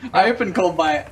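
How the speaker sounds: laughing through the words. Real, not acted. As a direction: laugh while saying it. Laughing at own bad luck.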